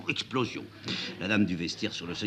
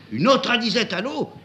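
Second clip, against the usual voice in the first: incredulous echo; 13 semitones higher, 7 dB louder than usual. A narrator's reading. Prince voicing, high surprised.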